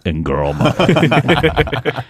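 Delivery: telling the critic what he just ate; teasing reveal. Retold or imitated. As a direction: savour the punchline. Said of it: "we said".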